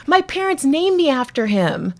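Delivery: high, bright earnest reveal; earnest young man. Retold or imitated. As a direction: explain it with wonder. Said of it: "he goes".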